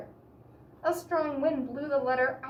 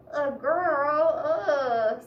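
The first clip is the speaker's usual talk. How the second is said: loud, disgusted groan; disgusted ugh; 4 semitones higher, 7 dB louder, much slower than usual. A narrator's reading. Eddie groaning loudly as a girl walks into the party. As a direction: sound grossed out. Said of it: "said Eddie".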